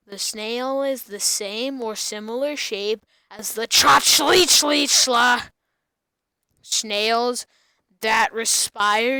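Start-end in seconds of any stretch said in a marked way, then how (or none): 3.7-5.4 silly gibberish. Acted. Nonsense word blurted loudly.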